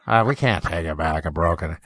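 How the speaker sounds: lightly voiced clerk. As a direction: play the unbothered store clerk. Clerk repeats refusal.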